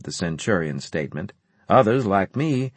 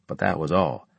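usual speaking voice

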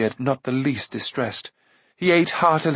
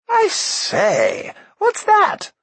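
put-on high, louder posh-boy exclamation; eager cry; 4 dB louder, much slower than usual. A narrator's reading. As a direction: burst out with delight. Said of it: "cried Prince Rune".

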